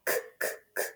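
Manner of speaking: three short, voiceless "K" clicks; letter sound. Shown as a demonstration. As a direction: tap out "K, K, K" softly in the throat. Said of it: "do our sounds".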